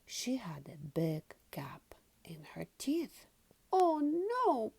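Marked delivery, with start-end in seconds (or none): 3.7-4.7 high little-pig cry of dismay; a child pig's high, worried cry; Peppa crying out. Acted. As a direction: squeal it out in alarm as a small girl. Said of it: "Peppa cried".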